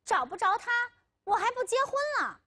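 high, fast angry retort; heated, rushed outburst. A narrator's reading. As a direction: fire back indignantly, pitch shooting up. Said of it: "阿龙生气地说".

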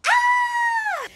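high squeal of delight; excited scream. Real, not acted. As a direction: shriek with joy. Grito de emoción al empezar. (grito agudo de emoción)

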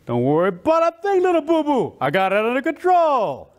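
big, confident cartoon bear; swaggering bear voice, swooping up in pitch; Yogi Bear. Bear reassures his little friend, confident cartoon bear voice. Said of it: "Yogi Bear was bigger than life".

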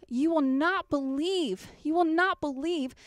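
you will not believe, drawn out slowly with weight on "will"; slow emphatic build. Acted. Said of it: "as that Samaritan woman".

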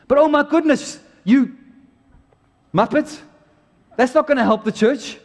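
exasperated outburst. Real, not acted. Exasperated rant at preacher.

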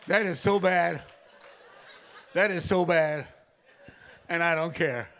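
can't stop laughing at his own joke; preacher cracking up at his own story, laughing. Real, not acted.

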